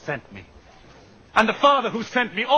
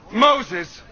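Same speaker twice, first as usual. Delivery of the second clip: louder, a sharp call of Moses; sudden call out; 4 dB louder than usual. Acted. Jesus warning the crowd, calling out Moses. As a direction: hit the name Moses loud. Jesus naming his accuser.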